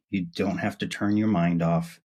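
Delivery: usual speaking voice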